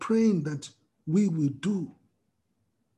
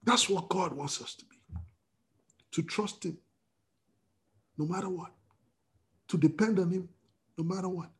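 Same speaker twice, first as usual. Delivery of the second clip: hushed; 7 dB quieter than usual. Acted. Hushed turn in sermon.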